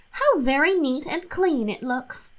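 lightly voiced cheerful housewife; pleased Catherine. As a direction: sound proud of a silly idea. Catherine admires the cellar.

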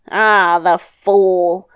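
slow, drawn-out scoff; scornful. Acted. Devil hearing of the ferryman, scornful. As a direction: stretch it out with contempt.

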